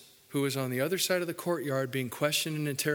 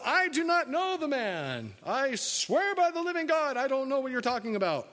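high, insistent mock oath, rushing at the end; Peter swearing denial; 8 semitones higher than usual. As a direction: protest too much in a high comic voice. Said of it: "he denied with an oath".

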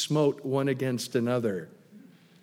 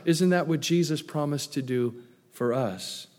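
usual speaking voice

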